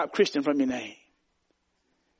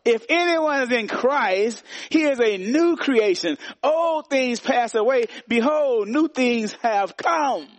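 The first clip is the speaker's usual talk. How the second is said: slow, higher proclamation of scripture; preaching the verse; 7 semitones higher, slower than usual. Acted. Pastor proclaiming Second Corinthians to the church, slow and high. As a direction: lift the verse slowly, like a preacher.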